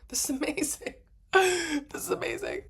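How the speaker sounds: high, delighted retold marvelling; giddy amazement. Traveler marvels at not having to shuffle around, giddy amazement. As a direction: marvel in a high voice, laughing a little. Retelling delight at same-side doors.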